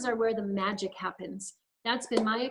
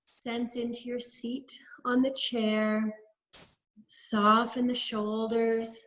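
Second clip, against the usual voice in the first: guided meditation. Acted. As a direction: lead them gently through each breath, unhurried. Leads a guided breathing practice.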